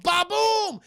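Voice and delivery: big high, loud "ba-boom"; imitated explosion. Streamer acts out the theater blowing up, booming sound. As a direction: boom it out like a blast.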